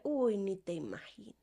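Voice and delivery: hushed whispered jab, almost no voice; whispered retort. Retold or imitated. To a judging mother in the supermarket, a hushed whisper. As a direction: whisper the comeback under your breath.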